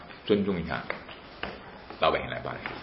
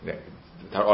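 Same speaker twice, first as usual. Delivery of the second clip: normal talking voice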